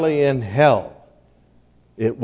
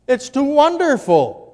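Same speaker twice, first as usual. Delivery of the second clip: marvelling; 9 semitones higher than usual. Real, not acted. Preacher marvels at the cross.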